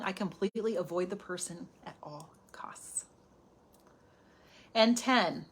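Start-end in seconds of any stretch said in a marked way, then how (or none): 0.0-3.0 confessing whisper. Real, not acted. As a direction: whisper it like a guilty secret. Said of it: "Not only do I bite my tongue".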